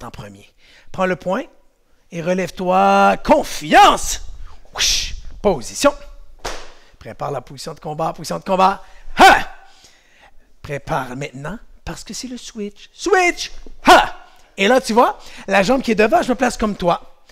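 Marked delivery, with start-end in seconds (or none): 3.2-4.2 loud, higher cry of confiance; shouted affirmation. Shown as a demonstration. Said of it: "coach shouts affirmation". 9.2-9.5 loud high kiai; karate kiai. Shown as a demonstration. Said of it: "kiai shout". 13.8-14.3 loud high kiai; karate kiai. Shown as a demonstration. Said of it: "kiai shout".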